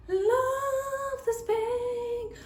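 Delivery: high, slow drawn-out love; gushing. Real, not acted. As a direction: sing the love out.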